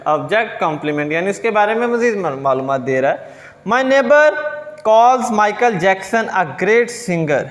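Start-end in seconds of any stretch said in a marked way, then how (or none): 3.7-7.4 slow example read. Shown as a demonstration. Teacher reads example sentence slowly.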